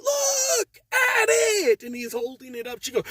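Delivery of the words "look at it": high, loud and very slow, each word stretched; drawn-out mock yell. Customer stretching his yell at the manager, very slow mock shout. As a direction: imitate him dragging it out in anger. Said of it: "Stop yelling at me".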